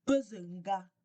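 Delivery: "pezenga" with the pitch lifting on "ze"; teacher showing the rise on "ze" in "pezenga"; rising ze. Shown as a demonstration. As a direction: push "ze" up in "pezenga". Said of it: "le ze là monte".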